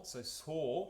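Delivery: "saw" with an open OR vowel; Russian O. Shown as a demonstration. To the choir, correcting the O vowel: open "saw". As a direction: say "saw", not "so". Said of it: "No, it's not O H, it's saw".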